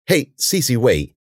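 exasperated call to wait. A narrator's reading. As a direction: try to stop someone, mildly exasperated. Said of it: "then exasperated".

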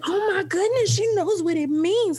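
high, drawn-out astonishment; retold amazement. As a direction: react like a parent floored by a toddler. Mother reacting to toddler's big word.

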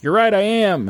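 preen like a vain king; smug Herod. Retold or imitated. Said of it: "And Harold's like".

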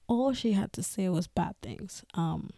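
normal talking voice

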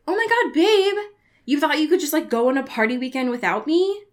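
put-on high, loud excited boyfriend. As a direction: gush it out, thrilled to see her. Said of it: "he's like".

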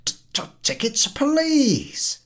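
high, loud, impatient stammer. Acted. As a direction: snap the stammered demand. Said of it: "repeats impatiently".

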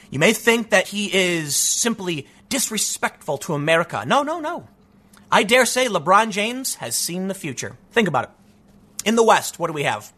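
ordinary talk

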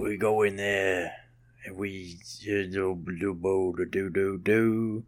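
low mumbled action-star drawl; Steven Seagal impression. Podcaster fumbling the action-star bit, low mumble. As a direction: trail into slow, soft nonsense syllables. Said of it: "If you do Steven Seagal's voice".

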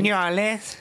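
high-pitched, squashed "New Orleans"; mimicked video pronunciation. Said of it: "I'm referencing a video I saw".

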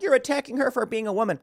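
high-pitched mocking protest; whiny high voice of imagined objectors; mock outraged feminists. Host mimics feminists' objection, high mock outrage. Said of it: "feminists are gonna be like".

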